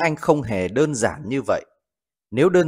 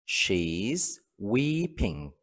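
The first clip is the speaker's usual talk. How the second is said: slow model reading; 3 semitones lower, 7 dB quieter, much slower than usual. Shown as a demonstration. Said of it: "Ví dụ, cô ấy đang khóc".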